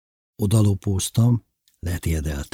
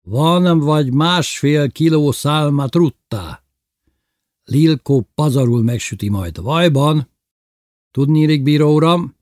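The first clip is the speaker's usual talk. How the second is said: loud, raised, pleased boasting; happy showing-off; 7 semitones higher, 5 dB louder than usual. A narrator's reading. Bébi presenting the trout to the judge, happily loud. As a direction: show off the catch happily, voice lifted. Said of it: "Boldogan mutatta a zsákmányt".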